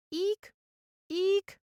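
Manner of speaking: short, very high squeaks; tiny scream that comes out as a high eek; mousy squeak. A narrator's reading. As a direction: squeak like a mouse. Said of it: "he squeaked".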